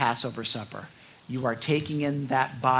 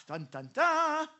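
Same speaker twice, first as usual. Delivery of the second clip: high, slow mock dramatic dun-dun-dun; ominous sting; 14 semitones higher, slower than usual. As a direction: sing a playful suspense sting. Dramatic ominous sting after boast.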